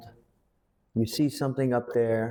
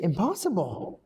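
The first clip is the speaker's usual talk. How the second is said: high and breathless Impossible; stunned disbelief; 8 semitones higher than usual. Shown as a demonstration. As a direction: whisper out your shock. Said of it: "You're so, so surprised".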